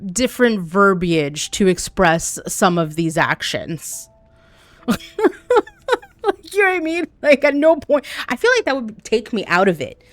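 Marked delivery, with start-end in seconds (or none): 4.9-5.6 high laugh. Real, not acted. Laughing at chat.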